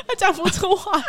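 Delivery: ordinary talk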